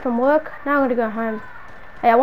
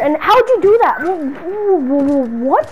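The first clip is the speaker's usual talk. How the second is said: loud, high demanding questions; shouted disbelief at the intruder; angry confrontation; 4 semitones higher, 5 dB louder, slower than usual. Acted. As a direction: confront her, outraged. Confronting stalker friend in house.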